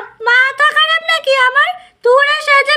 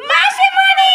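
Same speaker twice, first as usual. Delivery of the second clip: shout; 6 semitones higher, much slower than usual. Acted. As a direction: yell out to auntie with sudden glee. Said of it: "তোরা এরকম ভাবে চিল্লিয়ে উঠলি কেন?".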